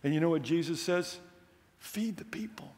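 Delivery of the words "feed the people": soft command. Acted. Quoting Jesus' soft command.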